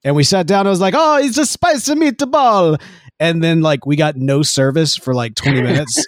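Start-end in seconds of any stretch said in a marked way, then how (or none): 1.0-2.8 much higher, slow, hammed-up Italian accent; a mock Italian accent drawn out for laughs. Retelling ordering at a New York restaurant in a mock Italian accent. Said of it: "Why did you have to do the accent".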